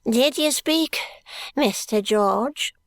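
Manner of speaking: put-on slow, high, creaky old miser; a slow quavering old miser; old man voice. A narrator's reading. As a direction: croak it like a crafty old miser.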